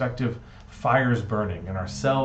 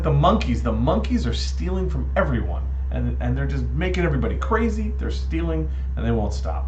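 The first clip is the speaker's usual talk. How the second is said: loud, raised-pitch complaint; alarmed son; 5 semitones higher, 5 dB louder than usual. Acted. Son tells Noah monkeys steal from everyone, alarmed. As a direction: report the trouble loudly and urgently.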